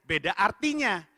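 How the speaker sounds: ordinary talk, nothing marked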